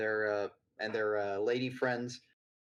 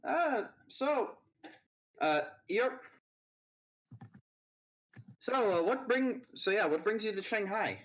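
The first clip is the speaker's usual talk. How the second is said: put-on high-pitched young woman's voice; [name]'s voice; 9 semitones higher than usual. [name] greets an old acquaintance in a higher voice. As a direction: speak up high as the student.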